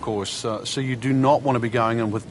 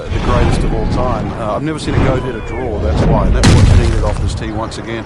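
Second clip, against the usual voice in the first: louder, punchy TV golf call; 8 dB louder than usual. Real, not acted. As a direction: lean in and lift the volume. Joking about the goat nickname.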